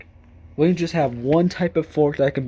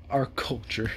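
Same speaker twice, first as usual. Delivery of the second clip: mock-posh echo; 2 semitones lower, 6 dB quieter than usual. Repeats the phrase mockingly.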